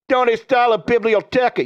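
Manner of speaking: mock a Boston guy attempting Spanish; Bill Burr. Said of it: "You sound like Bill Burr speaking Spanish".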